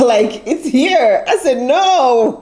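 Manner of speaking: slow, high laughing no; laughing disbelief. Retold or imitated. (incrédule en riant, lent et aigu)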